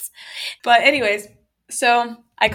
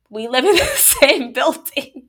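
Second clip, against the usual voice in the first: higher, louder, slow quote through laughter; drawn-out words breaking into a laugh; laughing retort; 6 semitones higher, 5 dB louder, slower than usual. Retold or imitated. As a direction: crack up while imitating the neighbor. Laughs while quoting the neighbor.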